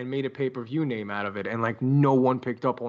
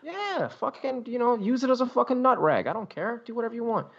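high-pitched, exaggerated indifference; dismissive rant; 9 semitones higher than usual. Waving off the offended fan, dismissive. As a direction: wash your hands of it in a high voice.

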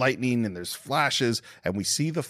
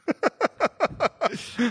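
high shrieking laugh. Real, not acted. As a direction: burst out in a shrill laugh. Big laugh after cruise.

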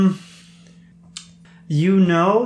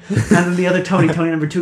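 ordinary talk, nothing marked

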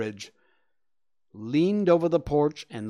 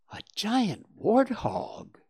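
whispered surprise; 8 semitones higher, slower than usual. Acted. Willie feigns surprise.

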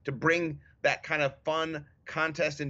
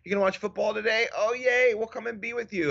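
higher, brighter imitation of the family's cheer; cheery family voice; 7 semitones higher than usual. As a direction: chirp it like a happy family member. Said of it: "they say stuff like".

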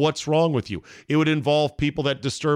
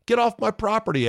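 shouted; 10 semitones higher than usual. Pretend. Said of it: "You must be thinking".

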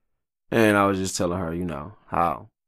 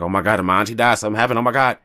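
mock panic; 4 semitones higher, 6 dB louder, faster than usual. Panicked thought about sick auntie.

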